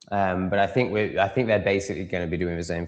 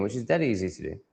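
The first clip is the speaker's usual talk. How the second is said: ordinary talk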